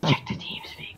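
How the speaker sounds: soft, whispered hint; covert whisper. Pretend. Player hinting to check TeamSpeak, covert whisper. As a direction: slip it in under your breath.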